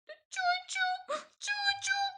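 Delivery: very high, soft squeaks; tiny squeak after a fall. A narrator's reading. The mouse tumbles down, very high soft squeaks. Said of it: "એવું બોલવા લાગ્યો".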